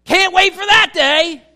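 eager cry. Pretend. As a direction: cry out with longing for that day. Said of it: "we should be saying".

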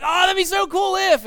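high, loud mock gushing; gushing fan. Preacher acting out someone admiring a person in their life, gushing. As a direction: gush like an overexcited admirer.

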